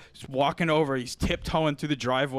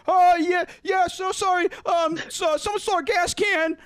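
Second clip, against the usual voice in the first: high, flustered, panicked babble; panicky squeak; 4 dB louder than usual. Retold or imitated. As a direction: play the nervous roommate caught off guard, voice shooting up. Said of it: "Bryson panics and he's like".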